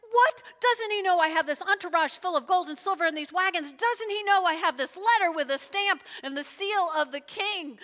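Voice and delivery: loud, high-pitched indignant outrage; furious. Retold or imitated. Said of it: "He gets furious about this thing".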